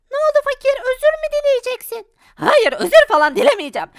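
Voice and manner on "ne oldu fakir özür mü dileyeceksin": put-on high, cheeky kid asking; mocking child voice, high. Child taunting father, high childish voice.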